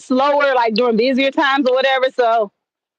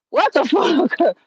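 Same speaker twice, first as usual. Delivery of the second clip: freaked-out disbelief; 4 semitones higher, slower than usual. Retold or imitated. Acts out freaking out at coincidence.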